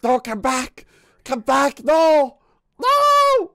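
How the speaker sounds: loud cries climbing to a shriek; desperate calling. Acted. Calls desperately after someone in game.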